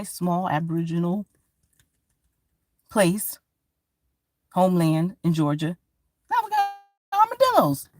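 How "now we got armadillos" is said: high squeaky disbelief at armadillos; shocked squeal. Acted. Comic telling the crowd, in a shocked squeal. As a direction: squeal it like you can't believe it.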